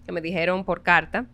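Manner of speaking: ordinary talk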